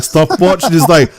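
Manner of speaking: ordinary talk, nothing marked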